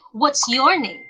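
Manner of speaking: repeated model question falling on "name"; "name" glides down at the end; falling intonation. Shown as a demonstration. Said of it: "falling intonation use the final stress".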